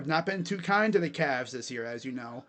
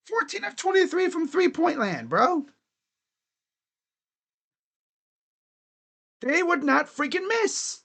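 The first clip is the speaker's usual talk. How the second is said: exasperated; 8 semitones higher than usual. Real, not acted. Disbelief at opponent shooting.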